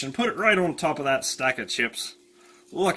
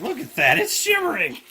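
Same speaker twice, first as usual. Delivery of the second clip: much higher, excited cry; delighted squeal; 12 semitones higher, 4 dB louder than usual. Real, not acted. Cook marveling at the shimmering oil, squealing much higher.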